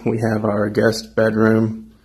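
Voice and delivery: normal talking voice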